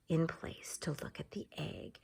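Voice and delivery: hushed, breathless narration; teacher whispering as animals freeze; suspenseful whisper. Acted. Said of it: "suspense in story".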